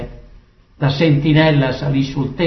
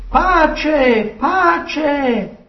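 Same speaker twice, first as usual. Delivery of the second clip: calling out; 11 semitones higher than usual. A narrator's reading. Ahimaaz greets the king with peace, crying out. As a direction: hail the king from afar, pitched up. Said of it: "gridò al re".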